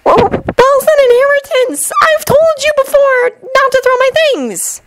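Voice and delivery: very high, shrieky scolding; furious shout. A narrator's reading. Shinobu scolds Jun for throwing her cards, furiously. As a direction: squeal it out in girlish fury. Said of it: "She shouted furiously".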